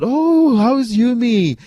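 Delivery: a happy, high-pitched phone greeting; delighted surprise. Retold or imitated. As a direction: voice her pleased surprise on hearing the name.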